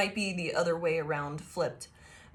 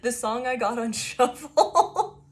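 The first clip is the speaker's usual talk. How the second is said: slow, breaking into laughter mid-line; laughing too hard to finish; 5 semitones higher, 7 dB louder, slower than usual. Real, not acted. Cracks up announcing song.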